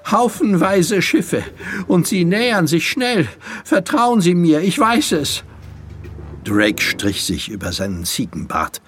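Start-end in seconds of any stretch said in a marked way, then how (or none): 0.1-5.4 high, pressing warning; high-voiced, agitated young messenger; urgent boy. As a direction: insist that he believe you. Boy urgently warns of Armada.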